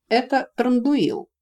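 slow, high, loud disdain; drawn-out, contemptuous naming; spat in disgust. A narrator's reading. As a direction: spit the name out slowly. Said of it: "сплюнул Нори".

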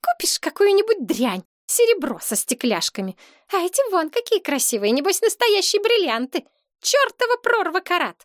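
high, squeaky sneering complainer; scornful girlfriend. A narrator's reading. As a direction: sneer at his gifts in a high girlish voice. Voices the quarrelling girlfriend. (презрительно, писклявым девичьим голосом)

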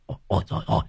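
giọng rít hoảng loạn giục chạy; hốt hoảng kêu mọi người chạy. Acted. Lão Hậu hoảng hốt bỏ chạy. (lão Hậu hoảng sợ giục chạy)

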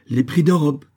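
high, loud, final ruling; cutting short. A narrator's reading. Governor leaves the lamenting merchant, cutting him short. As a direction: cut him off, "d'Europe" falling. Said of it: "coupa court en se retirant sur ses derniers mots".

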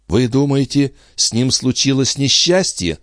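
high, anxious female character voice; alarmed exclamation. Acted. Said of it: "воскликнул Андре". (тревожный возглас, высоким голосом)

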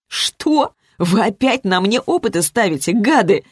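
indignant, high on the first word; girl protests at the experimenters. A narrator's reading. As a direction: protest in outrage. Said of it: "возмутилась Зоя".

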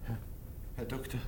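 frightened whisper; a patient whispering that he trembles. Acted. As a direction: breathe the words out fearfully.

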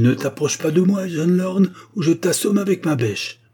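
high, forceful threat; crying out. A narrator's reading. Treadle threatening young Lorne with the spade, crying out. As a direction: warn him off loudly. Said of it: "s'écrie-t-il".

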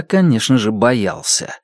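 usual speaking voice

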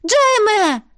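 high desperate yell; yelling with all her might. A narrator's reading. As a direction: scream his name with all her strength. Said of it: "заорала я изо всех сил". (отчаянный крик изо всех сил)

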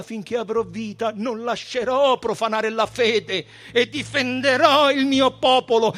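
high voice, building to a loud declamation; defiant high priest. A narrator's reading. Caifa vows to defend his people, high and increasingly loud. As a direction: start drawn out, swell into fierce defiance.

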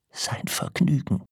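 breathy, half-whispered echo; stunned disbelief. A narrator's reading. Old man echoes his wife's word, stunned whisper. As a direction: repeat it under your breath, aghast.

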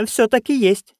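high, eager backing; heated agreement. Acted. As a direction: chime in hotly. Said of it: "горячо поддержала её нянюшка Як". (горячо поддерживая)

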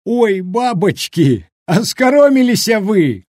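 high, drawn-out crowing, loud at the end; hilarious outcry. A narrator's reading. Villager rolling with mirth teases the women, crying out. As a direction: cry it out in helpless glee. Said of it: "покатываясь со смеху, на силу выкрикнул".

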